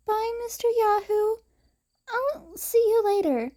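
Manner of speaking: put-on high, brave child voice; a small girl trying to sound cheerful; little girl's goodbye. Eri saying goodbye to Izuku, high brave child voice.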